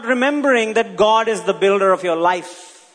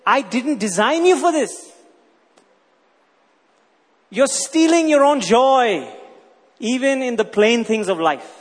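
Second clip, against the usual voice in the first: voicing God; 4 semitones higher than usual. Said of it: "And God is saying".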